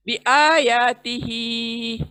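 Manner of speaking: बिआयातिही with आ, या and ही long, बी and ती quick; slow tajweed reading of बिआयातिही; drawn-out recitation. Shown as a demonstration. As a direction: stretch आ, या and ही in बिआयातिही. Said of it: "ती को fast पढ़ रही हूँ".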